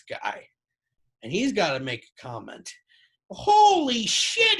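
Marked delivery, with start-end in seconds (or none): 3.3-4.6 very high, loud, drawn-out dad exclamation; high nasally voice. Retold or imitated. Dad Bob commenting on the man in a wheelchair, high and nasally. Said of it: "He talks in a real high, nasally voice".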